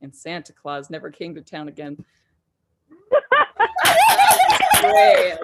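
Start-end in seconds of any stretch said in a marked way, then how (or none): 3.1-5.4 high laugh-filled delight. Real, not acted. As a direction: crack up laughing. Laughing at story end.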